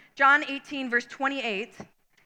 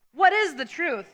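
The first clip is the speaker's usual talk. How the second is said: retorting; 3 semitones higher than usual. A narrator's reading. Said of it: "retorted Pilate".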